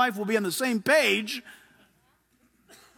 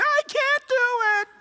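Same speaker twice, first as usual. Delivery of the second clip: high, slow, strained whine; whining Moses; 12 semitones higher, slower than usual. Acted. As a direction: squeal out the refusal helplessly.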